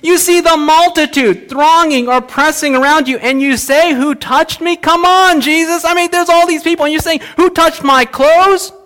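high, incredulous protest; almost cynical. Acted. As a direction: protest as if the question is absurd. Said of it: "almost cynical".

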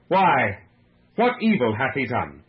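loud, emphatic questioning; projected appeal, punched first word. A narrator's reading. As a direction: challenge the crowd with a firm question. Pilate pleads with the people.

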